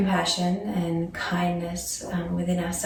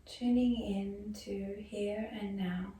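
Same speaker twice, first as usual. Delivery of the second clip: softer yoga nidra voice with long pauses; guided meditation; 10 dB quieter than usual. Acted. Yoga teacher guiding students into breath and body awareness, soft meditation voice. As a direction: ease them gently into stillness, leaving space after each phrase.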